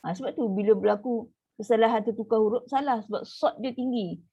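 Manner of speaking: ordinary delivery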